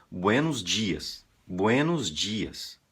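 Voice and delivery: slow "buenos dias" with Brazilian dj; mock a Brazilian saying "dias" as "djias"; Brazilian accent. Teacher imitating a Brazilian getting it wrong. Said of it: "tem brasileiro que diz".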